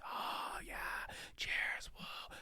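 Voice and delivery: hushed crowd cheers; whispered crowd. Acted. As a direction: breathe it like a distant crowd. Crowd reaction voiced by GM.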